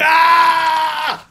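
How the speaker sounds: high mock pain cry; retold scream of pain. Seiko crushed underfoot, high mock scream of pain. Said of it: "Seiko crie".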